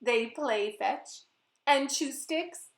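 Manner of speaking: put-on lively, sing-song narration for kids; storybook read-aloud. A narrator's reading. Author reading her book to children, lively and playful. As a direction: read it like story time for little ones.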